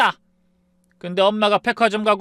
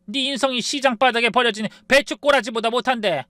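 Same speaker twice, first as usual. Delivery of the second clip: harsh scolding; 10 semitones higher than usual. Acted. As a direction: tear into her daughter. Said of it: "아주 호되게 꾸짖었습니다".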